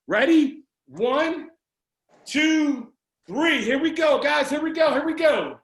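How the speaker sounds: rally the crowd for a big shout; hyped countdown. Real, not acted. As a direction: count them in, building energy.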